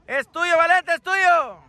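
high call with a stretched ending; urging shout. Real, not acted. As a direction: yell to a player across the pitch. Urging a player to take it. (grito de aliento)

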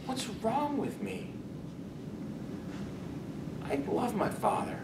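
sudden soft, hushed confession; quiet remorse. Acted. Ashamed son admitting he loves his father, hushed. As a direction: drop to a near hush of regret.

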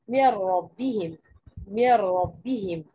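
"من ربهم" said slowly, the نون fully merged into the doubled راء; slow tajweed demo. Shown as a demonstration. Teacher reciting "من ربهم" for students, slow and merged. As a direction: draw out "من ربهم" with no نون sound. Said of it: "فين صوت النون؟ مش موجود".

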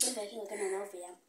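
ordinary talk

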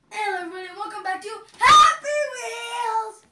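high, loud YouTuber intro impression; Jacksepticeye. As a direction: build to a shouted game title. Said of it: "let's do the best Jacksepticeye Happy Wheels impression".

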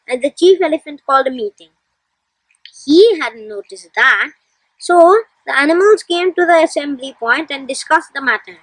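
ordinary delivery, nothing marked

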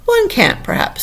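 high-pitched, fussy correction; quibbling Humpty Dumpty. A narrator's reading. Humpty Dumpty correcting Alice's remark, quibbling. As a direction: pick at the word from high up.

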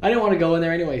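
loud huffy retort; petulant. Real, not acted. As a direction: pretend you never cared. Sulking at locked door.

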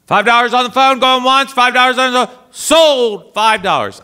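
high, loud auctioneer chant; auctioneer calling. Retold or imitated. Auctioneer calls out the five-dollar sale, high and loud. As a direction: call the sale going once, sold.

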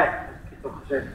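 soft whispered threat; menacing whisper. A narrator's reading. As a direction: breathe it as a quiet threat. Father's menacing aside to child. (dreigend gefluisterd)

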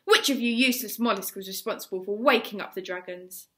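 loud, high, furious tirade; exploding at the boys. A narrator's reading. Gobber exploding at the shivering boys, furious shout. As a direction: roar it out in a towering rage. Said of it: "he exploded all over the place".

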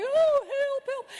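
high, loud pathetic plea; mock cry for help. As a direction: call out in hammed-up helplessness. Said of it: "and I'm going".

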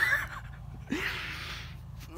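soft breathless laugh at himself; breathy laugh. Real, not acted. Dad laughing at his own exhaustion in the attic, soft airy laugh. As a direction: laugh it off, spent from the climb.

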